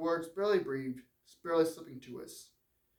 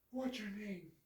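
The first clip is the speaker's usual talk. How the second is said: higher, lighter woman's voice; frail woman; 6 semitones higher, 9 dB quieter than usual. Acted. Dying warden's wife asks John about his scars, high and gentle. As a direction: ask it gently, a sick woman wondering.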